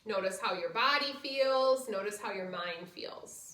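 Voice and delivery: soft, low guided relaxation voice. Acted. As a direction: ease them into rest gently. Leads final relaxation.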